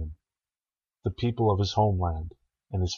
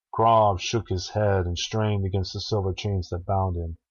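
ordinary delivery

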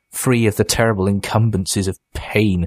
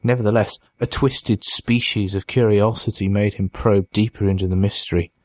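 ordinary delivery

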